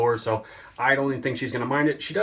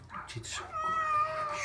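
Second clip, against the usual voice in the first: cooing; 6 dB quieter, much slower than usual. Real, not acted. Drawn-out praise to the cat.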